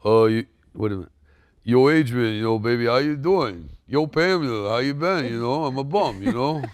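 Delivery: Rocky-style Stallone voice; mumbling Rocky Balboa tough guy; Sylvester Stallone impression. Shown as a demonstration. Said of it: "can you do".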